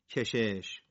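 normal talking voice

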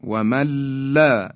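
wamalla, holding the l in و من لا; nun merged into lam. Shown as a demonstration. Tajweed teacher repeating the merge, doubled l in و من لا. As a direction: run و من لا together without the n.

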